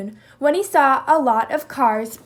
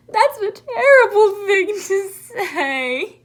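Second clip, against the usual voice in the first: slow, higher, crying voice; sobbing; 8 semitones higher, 6 dB louder, slower than usual. Acted. Moose sobbing at Jackal's cruel words, crying. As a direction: sob out the words. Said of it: "he sobbed".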